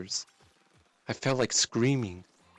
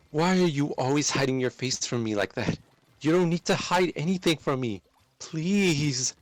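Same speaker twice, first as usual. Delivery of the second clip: higher, louder desperate plea; raised, anxious pleading; 6 semitones higher, 4 dB louder than usual. Acted. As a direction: beg her to stop hiding. Pleading, grabbing her hand.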